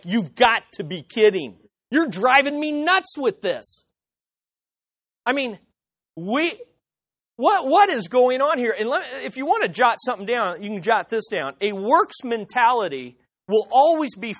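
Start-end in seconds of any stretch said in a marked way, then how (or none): 0.0-8.7 mock exasperation. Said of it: "they're like".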